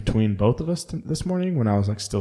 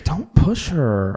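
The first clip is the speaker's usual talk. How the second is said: mock girlfriend; 7 semitones higher, 6 dB louder, much slower than usual. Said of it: "She's like".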